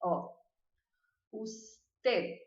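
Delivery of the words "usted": "usted" with the final D barely sounded; soft final D. Shown as a demonstration. Teacher demonstrating the final D. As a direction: end "usted" lightly on the D. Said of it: "pra vocês verem minha linguinha".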